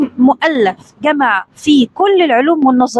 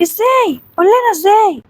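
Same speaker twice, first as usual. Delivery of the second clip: high, indignant challenge; angry; 7 semitones higher than usual. A narrator's reading. Vizier challenges the boy to explain, angry. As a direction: demand it crossly, pitch raised. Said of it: "الوزير قال بغضب".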